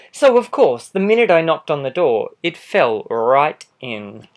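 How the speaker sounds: a lightly voiced sly wolf telling his side; charming wolf pleading innocence, animated; wolf narrator. Wolf narrating his own story.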